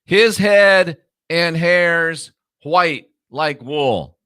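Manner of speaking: very slow reading with gaps between phrases; deliberate word-by-word reading. Shown as a demonstration. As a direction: set each phrase apart, slowly. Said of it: "Let's read it without the added words".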